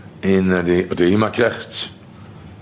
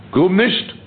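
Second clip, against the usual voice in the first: "Grub nicht" said much higher, rising at the end; raised "Grub nicht" after the whispered take; 8 semitones higher than usual. Acted. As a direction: lift "Grub nicht" up high like a call. Same words whispered then raised.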